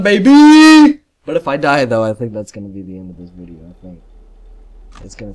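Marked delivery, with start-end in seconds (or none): 0.0-1.0 high, loud victory whoop; triumphant cheer. Real, not acted. Gamer proud of reaching the fifth night, excited cheer.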